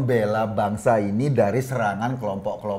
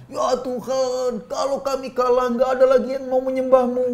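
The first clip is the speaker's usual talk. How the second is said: Neno; 10 semitones higher than usual. Acted. Host mimicking a political figure praying to God, mock-pleading. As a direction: plead to God in a high put-on wail. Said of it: "jangankan itu Neno".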